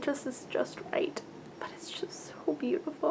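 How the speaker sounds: hushed awed whisper. Real, not acted. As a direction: whisper it like a secret treasure. Awed by yarn on camera.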